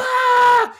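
loud, very high imitated scream; sudden full-volume mock demonic yell; demon's scream. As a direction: shriek loudly, all noise and intimidation. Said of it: "was just be loud".

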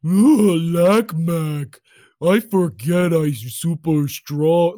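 slow dopey strongman voice with a whine; imitating Yak Mac. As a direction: drag out the dim brute's boast. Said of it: "it's like a".

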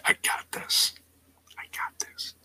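hushed whisper, barely voiced; whispered quote. Friend psyching himself up, whispered. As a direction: whisper it like a secret pep talk. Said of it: "And Jay's like".

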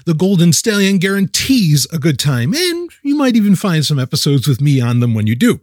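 loud, punchy radio ad voice; sponsor ad read. Acted. Host hyping a sponsor's call-in show to listeners, upbeat ad read. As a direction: sell it like a late-night radio promo.

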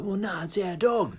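mock-protest as the owners; protesting dog owners. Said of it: "the owners are sort of a bit like".

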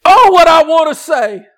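high, loud shouting preacher; fiery pulpit shouting; full-force preaching. Acted.

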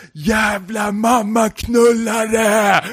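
long, high, drawn-out furious yell; full-throated storyteller's shout, high and slow; acted scream. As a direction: scream the curse slowly, stretching every syllable. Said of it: "skriker så här".

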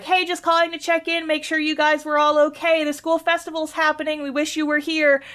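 high, chirpy friend on a voicemail; Kasumi. Retold or imitated. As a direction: act a schoolgirl checking in, pitched well up. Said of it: "it's just Kasumi".

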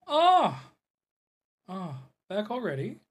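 loud, high delighted exclamation; excited greeting. Acted. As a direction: burst out in glad surprise.

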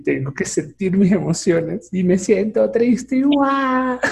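father imitating his daughter's sobbing, a little amused; mock crying. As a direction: parody a teen crying over her band. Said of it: "ella me dice".

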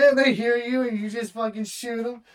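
excited. Real, not acted. Excited retelling.

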